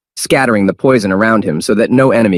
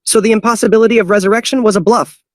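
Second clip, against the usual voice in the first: outraged disbelief; high confused outcry; confused exclamation; 13 semitones higher than usual. Acted. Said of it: "exclaimed in confusion".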